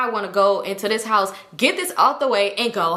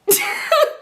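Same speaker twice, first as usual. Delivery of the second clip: shrill burst of laughter; high squealing laugh. Real, not acted. Narrator cracking up at the fight, high squealing laugh.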